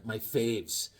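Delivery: usual speaking voice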